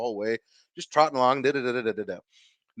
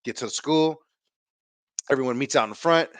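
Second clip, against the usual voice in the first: usual speaking voice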